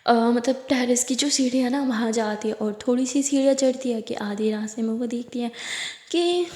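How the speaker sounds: plain reading voice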